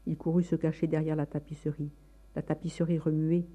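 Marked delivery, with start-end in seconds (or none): none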